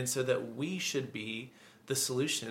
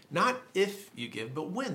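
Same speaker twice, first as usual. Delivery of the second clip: "Not" and "when" pitched higher to set them against "if"; contrastive stress; 5 semitones higher than usual. Real, not acted. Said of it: "When you give to the needy".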